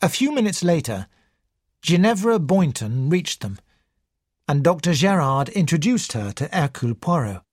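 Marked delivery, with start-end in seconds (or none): none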